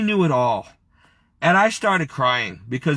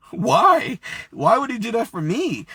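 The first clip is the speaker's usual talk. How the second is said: high-pitched, choked-up plea; reliving a tearful, overwhelmed question; tearful disbelief; 9 semitones higher than usual. Retold or imitated. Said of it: "really bawling my eyes out at this point".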